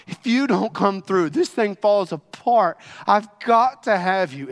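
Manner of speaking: loud pleading prayer in a changed voice; desperate plea. Acted. Believer in a storm pleading desperately with God. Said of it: "desperately pleading, desperately crying out".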